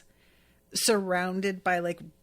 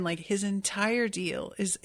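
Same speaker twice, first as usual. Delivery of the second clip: usual speaking voice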